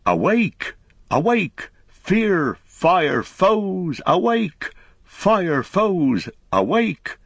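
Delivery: ringing horn call. A narrator's reading. Merry sounding the horn cry of Buckland, high ringing alarm call. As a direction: ring it out like a horn over the hills. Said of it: "up went the horn cry of Buckland".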